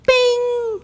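high, ringing bing; bing sound. Retold or imitated. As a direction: make the sound of a light clicking on. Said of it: "it's just like".

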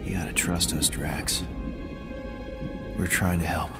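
low, soothing reassurance; gentle coaxing. Acted. Teammate asking his troubled friend for trust, gently coaxing. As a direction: coax him in a low voice.

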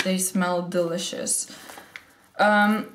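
ordinary delivery

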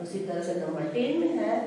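ordinary delivery

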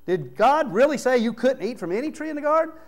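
high, sly, mocking tempter voice, pushed up; hammed-up Satan. Satan twisting God's words to Eve, high mocking sneer. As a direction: ham up the serpent's sneer. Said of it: "You know that's how he said it".

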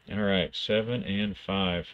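ordinary talk, nothing marked

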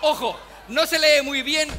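ordinary talk, nothing marked

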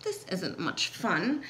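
low, slow, soft grumble; grumbling. A narrator's reading. Poor elephant stuck in the storm, grumbling. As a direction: grumble miserably to yourself. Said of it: "the poor elephant grumbled".

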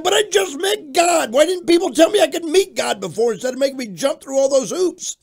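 high-pitched, excited holler; retold frantic protest. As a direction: plead loudly with the cop, overflowing. Retold frantic plea to the cop.

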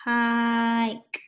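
"Hike" drawn out on a level note; slow. Shown as a demonstration. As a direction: hold the long I in "Hike" for the learners. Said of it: "Let's say it slow".

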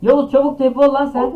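loud high frantic shout; urgent shouting. Real, not acted. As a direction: yell urgently at a friend. Urgently calling friend to teleport.

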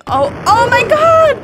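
very high, loud outcry; shocked scream. Pretend. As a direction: scream in playful horror. Explosion goes off.

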